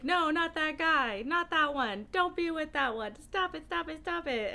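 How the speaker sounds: pleading with the book. Retold or imitated. Reader yelling at the book's romance choices, pleading. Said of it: "I spent the whole time just being like".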